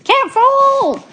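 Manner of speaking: high, drawn-out yell; loud alarmed cry; yelled warning. A narrator's reading. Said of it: "yelled Zigomar".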